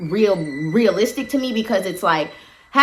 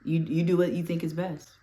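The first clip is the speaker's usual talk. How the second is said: hushed aside; 3 semitones lower, 10 dB quieter than usual. Retold or imitated. Boss's silent look, voiced.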